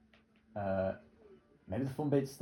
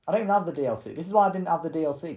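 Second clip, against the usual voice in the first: louder and higher, fed up with the maps; irritated gamer rant; annoyed rant; 6 semitones higher, 6 dB louder, faster than usual. Real, not acted. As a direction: complain hotly about the DLC. Ranting at the game.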